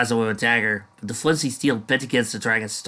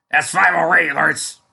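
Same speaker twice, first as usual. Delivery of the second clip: boastful shout; 7 semitones higher, 7 dB louder than usual. Acted. Boasting in battle.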